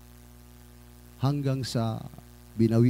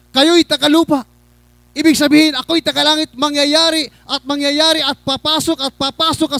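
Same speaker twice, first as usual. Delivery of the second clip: high, loud, hammering sermon warning; fiery preaching; 10 semitones higher, 8 dB louder than usual. Real, not acted. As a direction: warn the congregation at full pulpit force. Preaches warning at full force. (nag-aalab na pangangaral)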